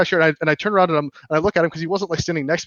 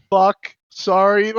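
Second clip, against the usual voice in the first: awkward apology; 5 semitones higher, 4 dB louder, much slower than usual. Retold or imitated. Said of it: "I walk up like".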